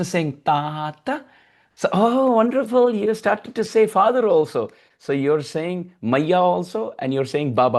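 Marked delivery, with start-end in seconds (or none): 1.9-4.7 put-on much higher, louder gushing over a baby; delighted praise. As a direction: squeal with joy at the baby. Mothers praise baby saying Tata.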